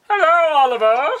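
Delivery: high-pitched puppet greeting; hammed-up puppet voice; puppet old man. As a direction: call out like a cartoon puppet. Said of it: "it's a puppet, basically".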